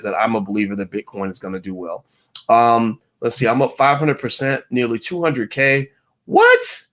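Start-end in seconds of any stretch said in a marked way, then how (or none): 6.2-6.8 high, loud incredulous what; a sharp jump up in amazement; amazed outburst. Real, not acted. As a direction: burst out in disbelief. Reacts to viewer's big gain.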